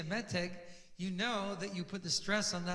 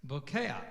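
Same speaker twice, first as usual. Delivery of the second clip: "Bokeah" with the stress on KE, falling away after; stress before last syllable; 3 semitones lower than usual. Shown as a demonstration. Teacher showing Hebrew students the exception, "Bokeah" stressed on KE. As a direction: stress the middle of "Bokeah", not the end. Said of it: "stress generally comes on the syllable before it".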